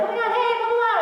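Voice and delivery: high, bright and friendly character voice; bubbly Lux voice. Retold or imitated. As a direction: chirp it like a cheerful anime heroine. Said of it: "that was Lux".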